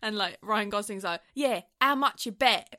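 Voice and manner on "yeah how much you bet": loud, higher mock English accent; overdone English accent, imitating an American actor; extreme English accent. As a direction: ham up a bad English accent. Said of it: "such an extreme English accent".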